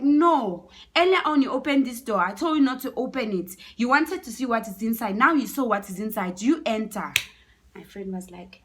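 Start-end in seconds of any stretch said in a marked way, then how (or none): 0.0-7.1 loud, high scolding; acted irate man refusing the plea; angry refusal. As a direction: bear down hard, accusing and commanding. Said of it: "was so mad".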